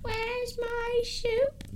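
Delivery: high, very slow, drawn-out whine; evil scientist. Acted. Evil scientist demanding soup from another room, high drawn-out call. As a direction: stretch it out in a silly high voice. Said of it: "The evil scientist calls from another room".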